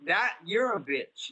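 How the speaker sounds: slow, deliberate, every word pressed; scornful slow put-down; slow emphatic insult. Real, not acted. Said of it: "I'm like".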